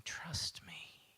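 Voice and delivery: hushed whisper of trust. Acted. Preacher voicing God to the anxious listener, whispered. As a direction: barely breathe it.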